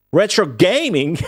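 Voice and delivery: retro gaming with an Australian twang, laughing; mock Aussie TV presenter; a hammed-up Australian ad voice; imitating Australian TV. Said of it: "that Australian T V video".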